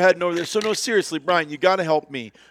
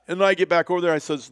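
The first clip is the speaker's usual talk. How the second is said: ordinary delivery, nothing marked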